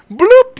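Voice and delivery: high, loud cartoon bloop; playful "bloop". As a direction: pop out a high-pitched sound effect. Imitated noise for grenade toss.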